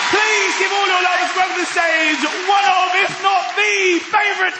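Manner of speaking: big compere intro; announcer build-up. Acted. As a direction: whip the crowd up for the act.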